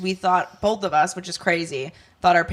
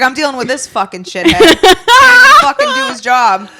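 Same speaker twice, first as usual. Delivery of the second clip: Boston president; 7 semitones higher, 10 dB louder than usual. Retold or imitated. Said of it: "He's like".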